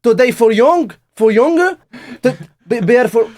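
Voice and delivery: high, loud insistent mock Indian English; Indian accent. Shown as a demonstration. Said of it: "avec un accent indien". (accent indien forcé, aigu et insistant)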